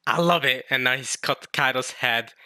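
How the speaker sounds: ordinary talk, nothing marked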